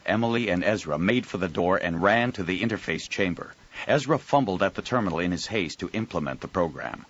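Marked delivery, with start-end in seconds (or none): none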